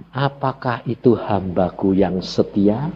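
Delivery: a lightly voiced, slow question from God. God asking the preacher if he is faithful, lightly slow. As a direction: ask it like a patient God who already knows. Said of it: "Tuhan bilang".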